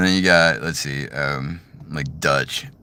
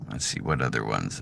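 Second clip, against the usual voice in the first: usual speaking voice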